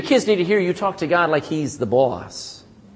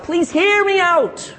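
begging; 7 semitones higher than usual. Retold or imitated. Preacher quoting David begging God, pleading insistently. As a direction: beg to be heard, pressing each phrase. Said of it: "begs God".

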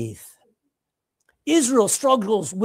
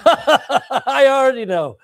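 laughing; 4 semitones higher, 6 dB louder, slower than usual. Acted. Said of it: "God starts going".

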